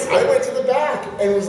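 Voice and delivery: ordinary talk, nothing marked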